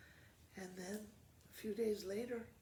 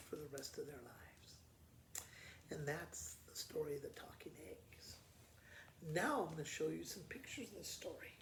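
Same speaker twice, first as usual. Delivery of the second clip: whisper; 8 dB quieter than usual. Real, not acted. Teacher closing the story softly.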